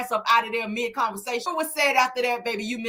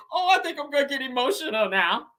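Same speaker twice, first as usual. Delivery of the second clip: mock fake crying; 2 semitones higher than usual. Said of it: "fake crying bullshit".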